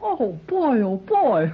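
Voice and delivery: usual speaking voice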